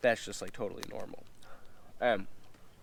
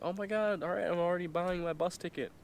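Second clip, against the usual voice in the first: put-on much higher, gushing girlish voice; excited girl; 8 semitones higher than usual. The girl agreeing to visit Tokyo, high excited gush. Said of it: "And she was like".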